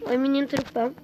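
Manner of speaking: usual speaking voice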